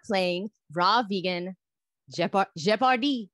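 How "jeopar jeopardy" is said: Jeopardy said the French way, zhe-par-DEE; Jeopardy in a French accent, zhe-par-dee. Retold or imitated. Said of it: "how my roommate says it with a French accent".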